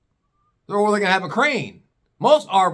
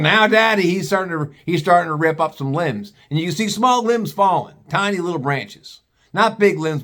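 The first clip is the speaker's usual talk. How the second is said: usual speaking voice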